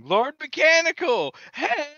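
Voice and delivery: high, loud drawn-out holler; screaming down an alley. Pretend. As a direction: yell it out like a lunatic running through the night. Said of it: "I'd just run down an alley screaming".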